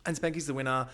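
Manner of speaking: usual speaking voice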